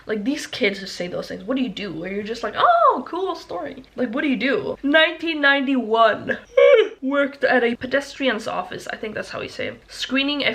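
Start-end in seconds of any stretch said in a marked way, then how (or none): 2.6-3.8 much higher, sarcastic "cool story"; mock dismissive. Mock dismissive reply to kid. 6.4-7.0 sudden high, loud laugh; loud laugh burst. Real, not acted.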